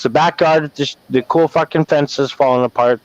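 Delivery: normal talking voice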